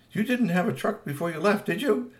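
suspicious mom. Acted. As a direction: raise a knowing eyebrow.

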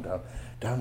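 ordinary delivery

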